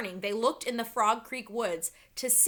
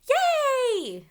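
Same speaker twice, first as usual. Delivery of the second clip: high, loud happy cheer; excited cheer; 12 semitones higher, 7 dB louder than usual. A narrator's reading. Annie spotting the returned treehouse, high excited cheer. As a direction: cheer at the sight of it.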